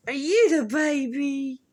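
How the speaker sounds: high, slow cooing at the dog; drawn-out sweet pet-talk. Real, not acted.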